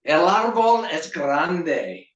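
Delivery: slightly hammed-up, high, slow declaration; proud declaration. Boy using his only Spanish sentence, proudly declaimed.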